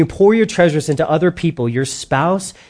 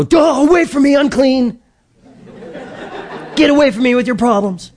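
much higher and louder, hammed-up disgust; cartoonish cry of unclean, pitched way up; mock repulsed shout; 11 semitones higher, 4 dB louder than usual. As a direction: shoo her away like a leper, overplayed. Pastor mocks shunning a woman.

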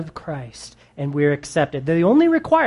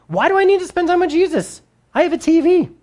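high-pitched, whiny mock objection; mock indignant protest; 12 semitones higher, 4 dB louder, faster than usual. Preacher mimics someone who feels no need for Jesus, mock indignant. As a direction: play the objector, pitched way up and a bit louder. Preacher acts out a reluctant believer.

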